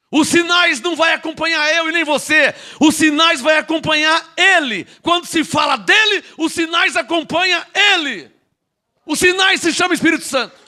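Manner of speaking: high, insistent pulpit cry; preaching shout. Real, not acted. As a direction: drive it home at full preaching force. Sudden full-force preaching climax.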